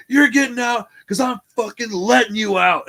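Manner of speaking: loud, high bellow of authority; retold screaming. Cop tells man why he's getting out, in mock screaming. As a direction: imitate a cop yelling full in the face. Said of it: "he screams at me".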